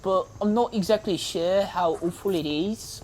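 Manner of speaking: an American's loose British accent; fake British accent. Shown as a demonstration. Gamer greeting British viewers in a fake British accent. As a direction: hams up a British accent for the Brits. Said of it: "Back to talking to my American voice".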